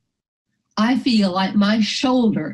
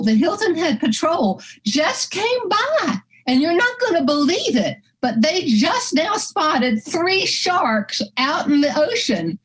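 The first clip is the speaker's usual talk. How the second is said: chatty beach woman; 9 semitones higher than usual. Retold or imitated. Woman telling a dog owner about sharks, high and chatty. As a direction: pass on startling gossip in a high voice. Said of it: "she said".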